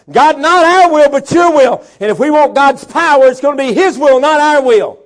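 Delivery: loud, high fiery preaching; full-force preaching. Acted. Preacher thundering at the congregation about God's power, fiery. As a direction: rouse the congregation.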